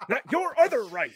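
high, amused imitation of Indy; exasperated correction, half laughing; mock exasperated. Imitating hero correcting Willie, mock exasperated. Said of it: "And he's, like, pointing".

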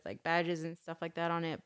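ordinary talk, nothing marked

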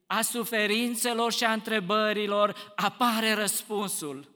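loud, fervent sermon climb, pressing each phrase; preaching at full force. Acted. Preacher to his congregation, ringing and fervent. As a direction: rouse the congregation with the joy of the resurrection.

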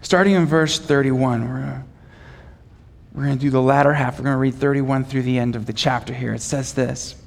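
normal talking voice

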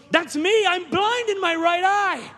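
high, slow mock cry of the woman; a half-acted shriek, stretched slowly; retold scream. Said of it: "And the woman screams".

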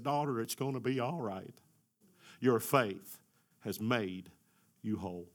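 softening to a hush as Jesus; gentle, fading reassurance; quiet Jesus reassuring the daughter; tender comfort. Acted.

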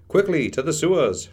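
rally the party to flee; urgent shout. A narrator's reading. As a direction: call out the order urgently. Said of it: "shouted Maginot".

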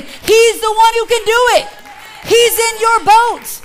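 building to a high, loud shout; rising preacher's cry; preaching at full force. Acted. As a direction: climax of the sermon.

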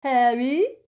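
loud, high mimicked call; mocking mimicry. A narrator's reading. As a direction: mimic his call to jeer at him. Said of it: "mimicked Crabbe".

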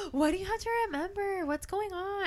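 gasp, then wonder in a high hushed pitch; intrigued gasp. Retold or imitated. Reader re-enacting being hooked by the mystery, intrigued. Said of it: "'Cause I was like".

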